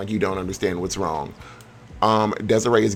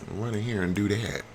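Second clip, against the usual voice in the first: usual speaking voice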